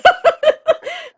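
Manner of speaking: delighted high laugh; high burst of laughter. Real, not acted. Shared joke about family nodes.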